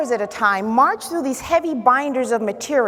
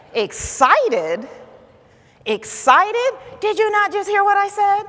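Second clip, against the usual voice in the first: mounting disbelief, rising high and loud; acted indignant questioning, pitch climbing; incredulous; 10 semitones higher, 3 dB louder than usual. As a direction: fire back in comic outrage. Acts out her disbelief at friend.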